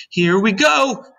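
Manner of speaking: high, loud call; excited cry. A narrator's reading. Miss Spider as the room lurches, excited cry. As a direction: shout it high as things lurch. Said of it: "cried Miss Spider".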